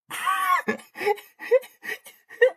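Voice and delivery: high, loud cackling; real hysterical laugh. Real, not acted. Streamer seeing Ken Jeong suggested, hysterical high laughter. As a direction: burst out in helpless laughter.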